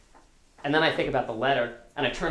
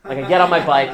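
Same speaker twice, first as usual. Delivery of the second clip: usual speaking voice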